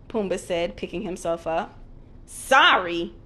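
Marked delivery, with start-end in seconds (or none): 2.3-3.1 Sorry? thrown back loud and indignant; shouted. Acted. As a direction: yell Sorry? back at the warthog. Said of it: "shouted Timon".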